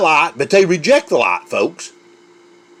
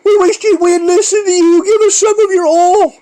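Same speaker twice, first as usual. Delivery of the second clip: high, pleading beggar's voice; a high, whiny plea for oil; pleading foolish virgins; 11 semitones higher, 3 dB louder than usual. Acted. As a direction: beg for oil in a high, strained voice. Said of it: "The foolish said to the wise".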